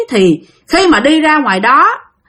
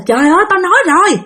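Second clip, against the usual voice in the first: higher-pitched, exasperated telling-off; angry scolding; 6 semitones higher than usual. Acted. Aunt scolds the woman for ignoring warnings, angry. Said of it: "Bà Sáu bà chửi rằng".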